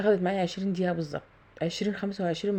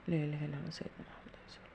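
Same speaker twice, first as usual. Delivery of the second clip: softer prayer spoken to herself; soft murmured prayer; 2 semitones lower, 10 dB quieter, faster than usual. Real, not acted. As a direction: quietly bless the work under your breath. Prayer while pouring batter.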